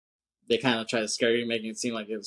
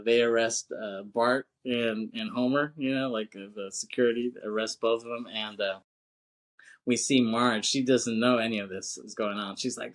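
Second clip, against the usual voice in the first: usual speaking voice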